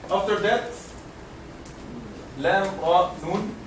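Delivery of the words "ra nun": usual speaking voice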